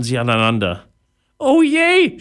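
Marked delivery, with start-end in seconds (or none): 1.4-2.2 high-pitched, loud pirate wail; groaning pirate. A narrator's reading. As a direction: moan it in a much higher voice. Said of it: "stöhnte Einauge". (stöhnender Pirat, hoch und laut)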